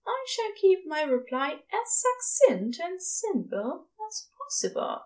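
high, proper, trailing to a breath; fussy formal angel, ending breathy; prim angel voice. Acted. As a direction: spell it out with prim care. Aziraphale's formal reply.

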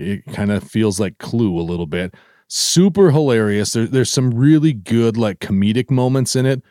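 ordinary talk, nothing marked

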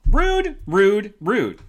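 loud, high repeated "rude"; mock outrage. Teasing a viewer who wrote he can hear her, mock outrage. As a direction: scold a cheeky viewer in jest.

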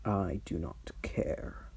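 looming archdevil, quietly dismissive; dragon voice. Acted.

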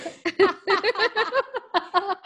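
a long high burst of laughter; incredulous laugh. Real, not acted. Host cracking up at the meter-long legend, laughing hard. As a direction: break up laughing at the absurd image.

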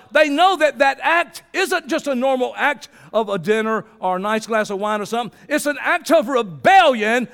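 loud, high preacher's cry; full-force preaching. Acted. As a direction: preach it out with fire to the congregation.

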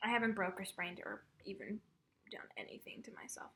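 soft whispered aside; whispering. Real, not acted.

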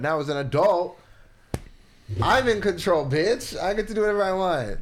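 loud, high triumphant boast. Acted. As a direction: gloat like you finally won. Triumphant adult gloating.

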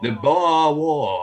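slow drawn-out Boston "Boer War", "pah waw"; Boston accent. Pretend. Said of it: "That's a very good Boston accent".

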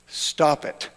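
flat near-whisper, no emotion; deadpan. Retold or imitated. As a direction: say it dry and hushed. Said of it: "He was a deadpan guy".

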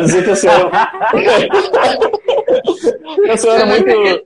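laughing through the words; can't stop laughing mid-sentence. Real, not acted. Retelling his frozen speech, laughing.

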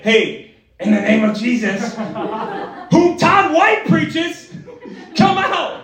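hammed-up exorcism command, climbing high; slow, drawn-out mock deliverance cry; mock exorcist. Copycat believer ordering a demon out, hammed-up and high. Imitating himself copying a healer's exorcism.

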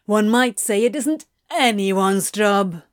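pointed, mocking retort. A narrator's reading. Crowley taunts Anthony after being slapped, mocking. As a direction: throw it back in mockery. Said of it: "Crowley mocked".

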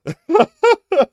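high, loud burst of laughter. Real, not acted. Laughs at own bad accent.

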